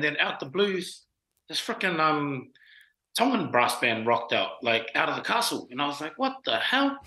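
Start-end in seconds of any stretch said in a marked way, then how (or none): 6.2-7.0 high-pitched baffled exclamation; retold disbelief. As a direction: recount a stunned reaction, pitched way up. Retold amazed reaction to band.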